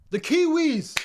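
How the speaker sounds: high, loud hype call for the Kiwis; excited shout. Real, not acted. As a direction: announce them with a big cheer. Hyping the next team.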